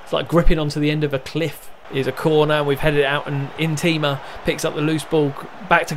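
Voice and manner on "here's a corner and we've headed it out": usual speaking voice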